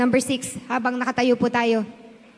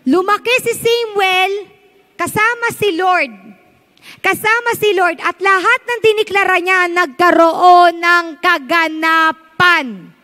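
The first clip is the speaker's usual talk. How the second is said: rising, louder preaching that swells to a slow end; preaching with full force; building preacher voice; 4 semitones higher, 6 dB louder than usual. Acted. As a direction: drive the verse home like a preacher.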